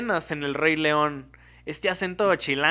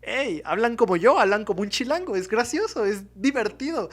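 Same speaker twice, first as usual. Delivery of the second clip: delighted viewer; 7 semitones higher, 4 dB louder than usual. Retold or imitated. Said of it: "lo que pensaban era".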